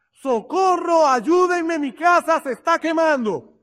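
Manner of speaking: high-pitched, acted cry for help. Neighbour calls churchgoers as his house burns, crying for help. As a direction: play a neighbour calling out in alarm. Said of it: "su vecino comienza a gritar".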